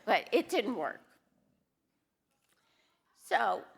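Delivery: ordinary talk, nothing marked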